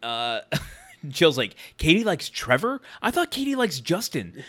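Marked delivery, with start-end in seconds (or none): 1.8-4.4 higher-pitched mock surprise, questioning; caught-up gossip. Wife caught up in high school drama, puzzled questioning. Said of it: "Jill's getting caught up in the high school drama".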